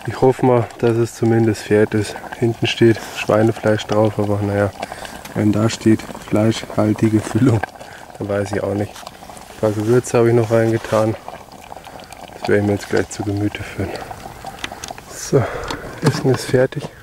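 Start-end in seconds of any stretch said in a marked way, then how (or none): none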